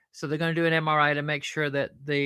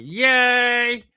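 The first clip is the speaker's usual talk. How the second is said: high, loud, drawn-out cheer; party cheer; 7 semitones higher, 7 dB louder than usual. Acted. Puppet cheers the idea of a party.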